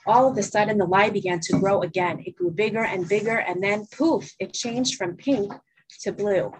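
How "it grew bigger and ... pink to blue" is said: ordinary delivery, nothing marked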